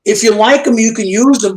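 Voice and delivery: ordinary talk, nothing marked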